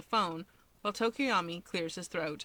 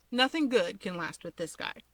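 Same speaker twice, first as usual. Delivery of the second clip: usual speaking voice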